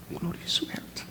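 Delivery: breathy whisper of glorious wealth; hushed glorious wealth; whispered punchline. Acted. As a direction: let the answer drop to a secret whisper.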